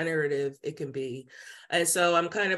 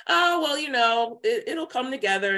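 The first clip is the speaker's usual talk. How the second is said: airy and much higher, brushing it off; breezy nonchalance; 6 semitones higher, 3 dB louder than usual. Retold or imitated. Answering friends about money with a mock carefree lilt. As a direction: shrug off friends' worry with lightness. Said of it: "I'm like".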